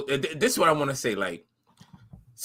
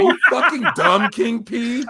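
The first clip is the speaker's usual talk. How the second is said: high, laughing mock quote; mock girlfriend voice; 5 semitones higher, 4 dB louder, slower than usual. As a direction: imitate her with a laugh. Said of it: "what accent did they use".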